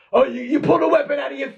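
goading him about the knife; shrill mocking tough guy; taunting big guy.

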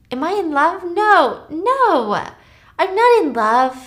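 high, swooping acted protest; protesting denial. Acting her denying love on the phone, protesting.

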